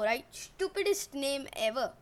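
plain reading voice

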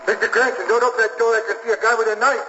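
alarmed warning shout. Acted. Cabbie spots man with knife.

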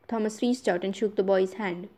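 plain reading voice

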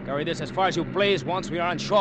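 loud, high plea to two men to stop fighting; urgent shout. Acted. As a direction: shout them apart over the engine. Breaking up quarrel over engine.

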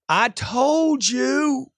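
very slow, high-pitched protest; emotional customer. Acted. Customer reproaching salesman who showed too much, emotional. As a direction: stretch it into a hammed-up upset wail. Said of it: "Why is he getting all emotional?".

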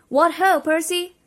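hearty high shout. A narrator's reading. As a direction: call it out loud and cheery. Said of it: "he shouted".

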